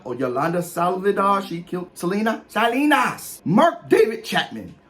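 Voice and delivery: ordinary talk, nothing marked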